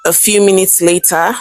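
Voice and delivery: plain reading voice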